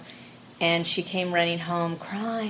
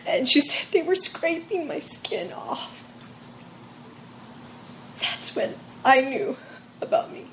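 breathy tearful whisper, voice breaking; whispering through tears about his sister's skin being scraped; emotional whisper; 6 semitones higher than usual. Real, not acted. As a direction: barely get the words out through tears. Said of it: "Even now I'm getting a little emotional".

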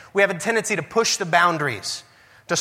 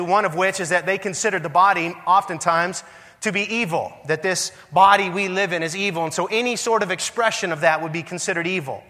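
ordinary talk

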